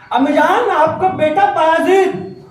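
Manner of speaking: high, loud, slow eager call; eager son. Acted. Son calling to his mother at the door, high and eager. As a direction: announce yourself hopefully.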